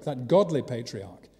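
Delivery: ordinary delivery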